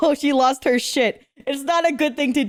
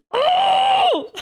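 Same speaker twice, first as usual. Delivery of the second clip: excited cry. Real, not acted. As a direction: yelp with real joy at the play. Watching team play well.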